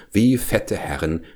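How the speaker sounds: ordinary delivery, nothing marked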